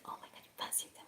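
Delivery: very soft, quick breathy whisper; barely voiced, astonished question; hushed amazement. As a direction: imitate a frail old man's whispered delight. Retold frail man's delighted reaction.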